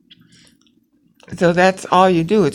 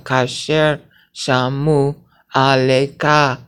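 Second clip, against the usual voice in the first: slow syllable reading; slower than usual. Shown as a demonstration. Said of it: "see how it's nine syllables".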